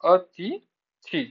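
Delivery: अतिथि, slowly and pointedly; slow correct form. Shown as a demonstration. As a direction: draw out अतिथि to model the right spelling. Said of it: "छोटी ई की मात्रा".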